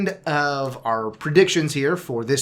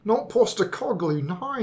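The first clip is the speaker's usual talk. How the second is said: put-on light Australian accent, higher pitched; 8 semitones higher than usual. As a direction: Postecoglou said in an Aussie accent. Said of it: "my well-practiced Australian slash New Zealand accent".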